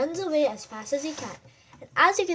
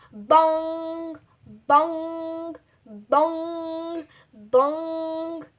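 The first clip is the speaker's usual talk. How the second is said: clock bongs; 3 semitones higher, 3 dB louder, much slower than usual. Acted. Clock strikes six.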